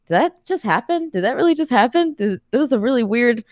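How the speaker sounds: high, incredulous retold gasp of disbelief; stunned disbelief. [name] reacting to Dwayne's odd greeting, high incredulous disbelief.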